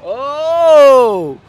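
high, loud exclamation; a sudden loud gasp of surprise; surprised oh. Real, not acted. (¡oh! sorprendido y fuerte)

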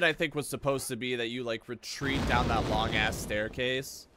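usual speaking voice

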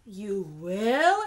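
pointedly unconvinced question; doubtful hen. A narrator's reading. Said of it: "asked the little red hen".

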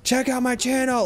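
mock high spammer yell; all caps spam. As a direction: shout it like a pushy self-promoter. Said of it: "Like, the all caps".

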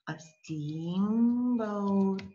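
"steamboat" stretched very long; slow dictation. Shown as a demonstration. Teacher writes English word down, slow drawn-out dictation. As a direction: hold each half of "steamboat" as if spelling it out. Slow writing, then usual repeat.

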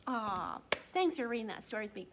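usual speaking voice

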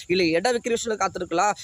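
usual speaking voice

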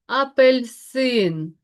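апельсин slowly by syllables, leaning on the last, СИН; stress on third syllable. Shown as a demonstration. Teacher dictating today's word to pupils, stressed syllable drawn out. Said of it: "падає на третій склад".

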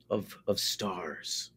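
ordinary talk

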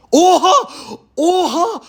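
high, loud shocked yells breaking into laughter; real stunned excitement, pitched high; excited shouting. Real, not acted. Gets rare brawler from shop.

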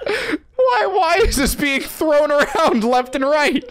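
high, slow mock-distressed squeal; retold bewilderment. Storyteller replaying his shock at the slur, high mock distress. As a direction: wail it in comic disbelief, nearly cracking up. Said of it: "I was just sitting there like".

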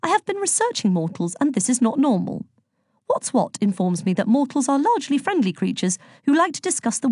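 lifted, formal and bluntly inquisitive; Virgo, matter-of-fact. Acted. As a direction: recite research findings with literal-minded confidence. Voices Virgo in the story.

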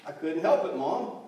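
put-on loud boyish excuse; acted self-justifying kid; defensive son. A narrator's reading. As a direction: plead your case to Mom. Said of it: "he defended himself".

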